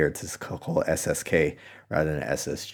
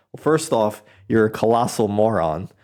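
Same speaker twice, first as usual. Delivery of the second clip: exasperated outburst; 4 semitones higher, 5 dB louder than usual. Real, not acted. Ranting at the panel.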